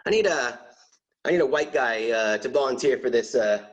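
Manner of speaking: ordinary talk, nothing marked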